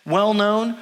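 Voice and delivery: ordinary talk, nothing marked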